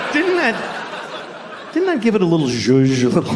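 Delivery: high, playful camp lilt; sing-song camp tease; campy aside. Pretend. Camp aside about zhuzh.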